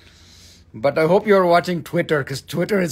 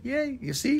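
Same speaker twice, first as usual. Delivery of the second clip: normal talking voice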